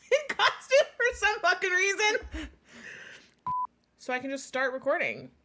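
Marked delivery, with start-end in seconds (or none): 0.1-2.5 high, loud words through real laughter; cracking up mid-sentence, pitch jumping; real laughing. Real, not acted. Cohost losing it over wet noises in costume, laughing. Cracking up during podcast outtakes.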